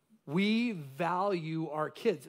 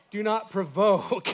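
laughing; 3 semitones higher, 7 dB louder, slower than usual. Shown as a demonstration. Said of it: "No, the parents don't submit".

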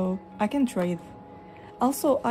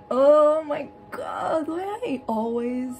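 slow, hammed-up fake sob, higher and louder; mock crying; 6 semitones higher, 4 dB louder, slower than usual. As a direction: wail it like a pretend heartbreak. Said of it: "Makes me so sad".

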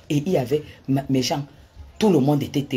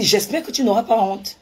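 superior, cutting taunt, pitched higher; haughty taunt; 5 semitones higher than usual. Retold or imitated. To the woman at the police station, a haughty higher taunt. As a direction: look down on her as you say it. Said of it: "la manière que je m'adressais à elle".

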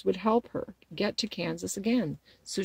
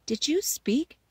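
high, wondering little question; 7 semitones higher, slower than usual. A narrator's reading. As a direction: ask it in mild amazement. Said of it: "asked the girl in wonder".

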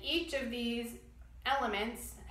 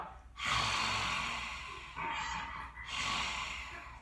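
long raspy throat breath; Darth Vader breath. Shown as a demonstration. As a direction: rasp the air through the back of your throat. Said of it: "Darth Vader breath".

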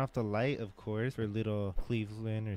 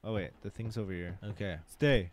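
ordinary talk

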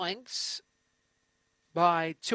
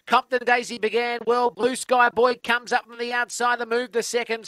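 race commentary; 3 semitones higher, 4 dB louder than usual. Acted. Live greyhound race call.

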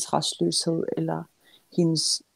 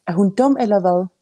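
louder irritated exclamation; exasperated outburst; 3 semitones higher, 7 dB louder than usual. A narrator's reading. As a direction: burst out as the cross mother. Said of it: "udbrød Anna".

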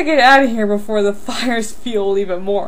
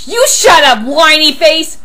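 loud, raised-pitch comeback; angry retort; 4 semitones higher, 7 dB louder than usual. Acted. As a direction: fire it back louder and higher. Acted name-calling quarrel.

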